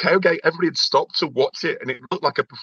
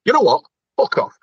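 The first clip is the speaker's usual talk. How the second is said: mock telling-off; 5 dB louder than usual. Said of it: "you just went".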